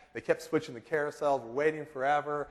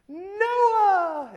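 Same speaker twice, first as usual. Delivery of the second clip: high, loud retold greeting shout; excited call; 8 dB louder than usual. Father spotting his young son at the airport, excited call. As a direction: call the name out high and long.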